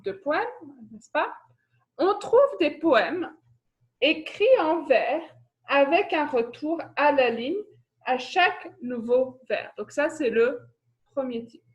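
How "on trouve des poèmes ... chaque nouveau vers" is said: measured classroom dictation with clear pauses. Real, not acted. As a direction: dictate it for pupils to copy, phrase by phrase. Recap for pupils to copy down.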